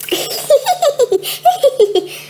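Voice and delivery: put-on giggling child; light high-pitched giggles. Florence giggles.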